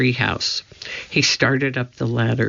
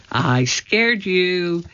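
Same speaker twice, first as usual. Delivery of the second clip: high, slow singsong taunt; teasing; 6 semitones higher, much slower than usual. A narrator's reading. Annie gloats after startling Jack, teasing. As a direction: draw it out to tease.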